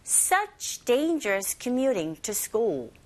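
slow, deliberate "Such dangerous commuting to school" for learners to copy; very slow teacher model; slow model reading. Shown as a demonstration. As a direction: pace it out word by word. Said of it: "저랑 똑같이 하실 수 있죠".

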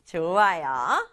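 usual speaking voice

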